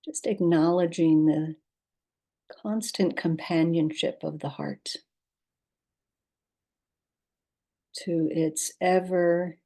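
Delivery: calm, unhurried guidance with long pauses; guided meditation. Acted. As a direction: lead listeners gently into rest, leaving space between instructions.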